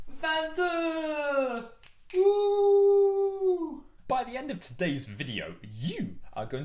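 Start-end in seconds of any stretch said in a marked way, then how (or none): 0.1-1.7 high, drawn-out last count; strained final count. Pretend. Teacher finishing squats while counting in French, high and drawn out. 2.2-3.8 high, loud, drawn-out "woo"; loud whoop. Pretend. Teacher cheers after counting squats, high long whoop.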